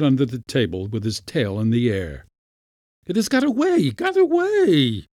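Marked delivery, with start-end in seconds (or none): 3.1-5.1 furious cry. A narrator's reading. Uncle as the mouse escapes, crying out furiously. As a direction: wail it high in outrage. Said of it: "cried Pyotr Demyanitch, looking furious".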